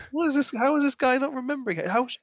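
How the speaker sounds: ordinary talk, nothing marked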